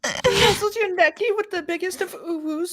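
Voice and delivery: high-pitched, hammed-up cutesy squeal; uwu voice. Outdoing a friend's nuzzle line, high uwu voice. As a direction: coo it in an exaggerated uwu voice. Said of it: "hitting that ooh-woo voice".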